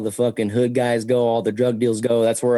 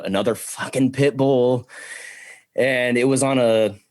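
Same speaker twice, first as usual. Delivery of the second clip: usual speaking voice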